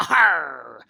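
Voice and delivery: loud hammed-up pirate "Arr"; big pirate call; pirate growl. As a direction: bellow it like a pantomime pirate. Playing pirate for the kids.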